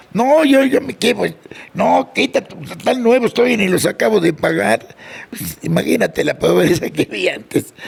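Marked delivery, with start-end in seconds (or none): 0.1-5.0 pitched up, fussing over the new shoes; a mother refusing to give up the new shoes; mock mother scolding. Mother scolding her son over new unpaid shoes, pitched up.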